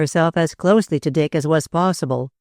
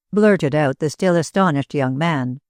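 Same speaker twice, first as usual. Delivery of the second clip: plain reading voice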